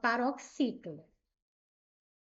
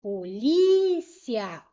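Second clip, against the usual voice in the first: "polícia" with LI stretched long and loud; po-LIII-cia, slow "polícia" with a strong second syllable; stress on LI; 8 dB louder, much slower than usual. Shown as a demonstration. Teacher proving li stays tonic, "polícia" with long, loud LI. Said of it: "o li continua sendo a sílaba tônica".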